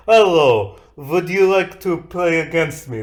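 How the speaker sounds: a hint of a Russian NPC offering a game; mild Russian accent inviting a player. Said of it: "That is Russian, not French".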